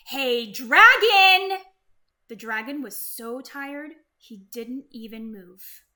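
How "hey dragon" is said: very loud, slow, long yell; shout as loud as she can. Acted. Elizabeth yells into the sleeping dragon's ear at full force. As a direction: yell right into his ear. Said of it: "shouted as loud as she could".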